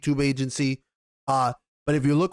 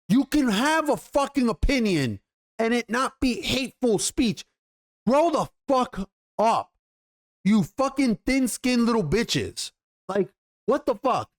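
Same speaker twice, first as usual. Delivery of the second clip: real outrage, voice pitched up, slowing on grow the fuck up; angry rant; 6 semitones higher than usual. Real, not acted. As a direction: tear into the critics with real anger. Ranting at Gina's critics.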